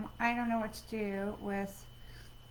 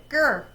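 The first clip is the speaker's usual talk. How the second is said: frustrated growl; 4 semitones higher, 9 dB louder than usual. Real, not acted.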